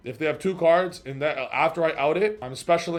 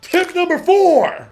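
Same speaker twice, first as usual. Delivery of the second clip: much higher, louder, slow call-out; loud announcement; 11 dB louder, slower than usual. Real, not acted. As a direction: announce the tip like a hype host. Announcing next tip.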